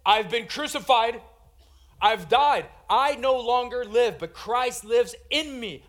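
ringing, emphatic scripture quote; forceful proclamation. Acted. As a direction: declare Paul's testimony with full conviction. Preacher proclaims Paul's words forcefully.

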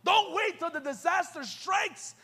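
ordinary talk

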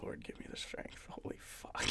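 whispered exasperated prayer; under the breath, pleading for patience; praying for patience through teeth. Real, not acted.

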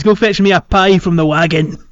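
ordinary delivery

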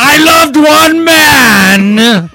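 slow, high, loud tragic wail; melodramatic lament. Acted. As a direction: wail it like a spurned villainess.

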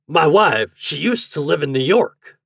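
high, loud character voice; talkative boarding-house host. A narrator's reading. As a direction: launch into a folksy discourse.

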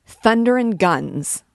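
captain spitting out a soldier's oath; loud oath. Acted. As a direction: bellow Thunder and guns. Captain ends rant with oath.

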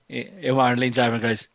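ordinary delivery

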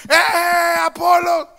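high, loud cheering shout; hammed-up crowd yelling his name; mock crowd cheer. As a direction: cheer Apollos like an excited crowd.

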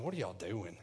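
low, soft, laid-back question; acted nonchalance, quiet and low; just chilling. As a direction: ask it idly, barely bothering. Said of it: "honey, just chilling".

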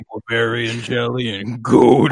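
imitated inmate voice, unlike the speaker's own; Nathan Fillion's inmate. Inmate threatens the new prisoner, in a put-on character voice. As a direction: put on a menacing prison brute's voice. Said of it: "I still have no idea how that's his voice".

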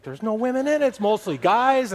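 high, loud mock defensiveness; indignant protest. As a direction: plead innocence in a retold quarrel. Acted quarrel, protesting his innocence.

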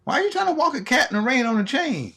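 mock shocked neighbour questioning the owner; incredulous onlooker. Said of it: "We'd be like".

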